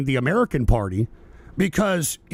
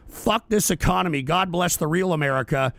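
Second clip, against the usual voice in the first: normal talking voice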